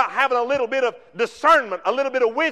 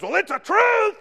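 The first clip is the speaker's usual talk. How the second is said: mock shout, much higher; mocking cold preacher; 9 semitones higher, 3 dB louder than usual. Mocking preachers who preach truth coldly, loud high shout. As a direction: jab it like a harsh preacher. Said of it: "our preachers nowadays says".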